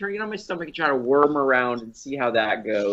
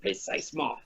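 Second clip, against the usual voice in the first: high, airy French word; French accent; 11 semitones higher, 12 dB quieter than usual. Acted. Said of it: "as they say in France".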